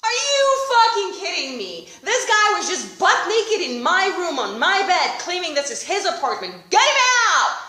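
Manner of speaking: loud, fed-up outburst; outraged demand. Retold or imitated. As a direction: insist they remove him. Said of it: "And I'm like".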